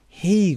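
"هی" with a drawn-out "ee"; long E. Shown as a demonstration. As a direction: stretch the vowel in "هی". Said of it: "یعنی صدای ای کشیده". («هی» با ای کشیده)

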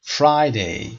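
FRI in Friday stressed; Friday with a strong first syllable. Shown as a demonstration. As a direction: lean on FRI, let day drop. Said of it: "استرس قسمت اول".

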